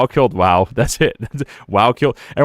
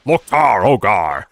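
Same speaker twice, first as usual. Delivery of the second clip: slow, drawn-out orc war cry, lightly voiced; Thrall voice; much slower than usual. Streamer voicing Thrall's war cry, slow and mock-solemn. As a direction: call it slowly like a proud orc. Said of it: "Thrall from Warcraft".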